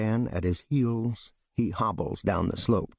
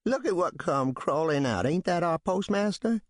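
jeering backwoods man; 10 semitones higher than usual. Acted. Townsman mocks the arriving man, jeering. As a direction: sneer it out to the crowd.

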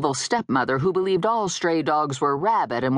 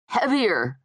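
higher, abrupt interjection; blurted out; 7 semitones higher than usual. A narrator's reading. Dead guy interrupts her protest, blurting. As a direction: burst in with the word. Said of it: "he blurted out".

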